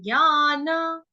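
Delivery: ज्ञान drawn out slowly, ग्यान sound; slow clear ज्ञान. Shown as a demonstration. Teacher shows students how to say ज्ञान, slow and clear. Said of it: "ज्ञान नहीं देंगे".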